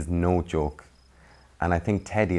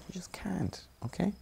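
stern whisper; 12 semitones higher, 10 dB quieter than usual. Pretend. Scolding a teddy bear softly.

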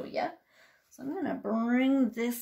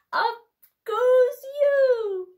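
much higher, louder squeal; squeal of delight; 13 semitones higher, 7 dB louder, much slower than usual. Shown as a demonstration. Librarian lifting baby high, squealing.

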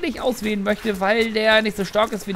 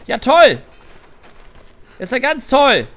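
sarcastic; 6 dB louder, slower than usual. Real, not acted. Sarcastic reaction to loot rule.

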